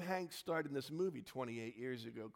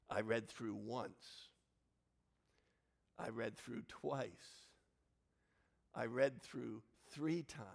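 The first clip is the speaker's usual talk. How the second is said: deliberate repetition; 2 semitones lower, slower than usual. Real, not acted. Slow repeated build.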